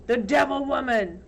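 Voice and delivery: loud scornful cry; brand her before the crowd; shouted accusation. Acted. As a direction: mob leader's shout. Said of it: "Trout shouted".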